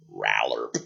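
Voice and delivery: very low, soft frog croak; deep amphibian burp of a call. Acted. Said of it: "it sounds like".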